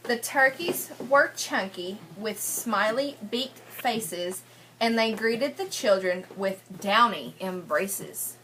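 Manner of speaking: sing-song read-aloud rhyme for a class; lilting picture-book reading voice; story reading to children. Acted. Teacher reads rhyming picture book.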